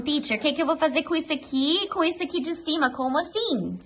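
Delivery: imitating a puzzled student; confused student. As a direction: play the overwhelmed pupil asking the teacher. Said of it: "muita informação".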